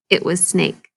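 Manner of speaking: usual speaking voice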